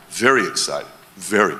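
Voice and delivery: low, soft, unbothered reply; lightly voiced laid-back guy who isn't worried; calm long-term thinker. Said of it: "you'll walk around the room like this".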